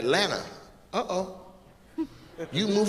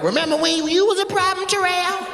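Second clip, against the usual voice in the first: mocking girl voice; 13 semitones higher than usual. Girls teasing in crowd voice.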